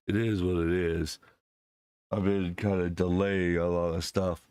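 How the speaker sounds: ordinary talk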